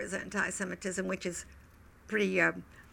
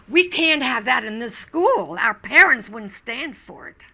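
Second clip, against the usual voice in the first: outraged refusal; 3 semitones higher, 12 dB louder than usual. Retold or imitated. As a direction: throw the words out in scandalized alarm.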